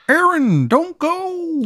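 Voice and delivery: high eerie pleading voice; high creepy appliance voice. Acted. Dishwasher voice calling out.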